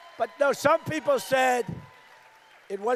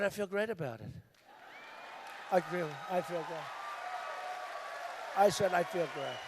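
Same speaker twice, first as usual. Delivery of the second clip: calm quiet; 6 semitones lower, 7 dB quieter than usual. Retold or imitated.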